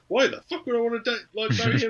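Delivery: hammed-up refusal to marry; mock outrage. Sophie refusing, voiced.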